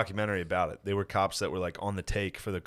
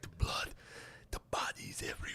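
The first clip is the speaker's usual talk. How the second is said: hushed, haunted whisper, hammed-up; overacting traumatized cop; 12 dB quieter than usual. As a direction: imitate an overacting witness in a ghostly whisper. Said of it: "this guy was overacting".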